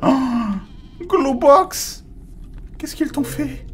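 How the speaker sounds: high, shocked exclamation; high surprised voice. Real, not acted. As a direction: gasp it out in shock. Discovering a character in game.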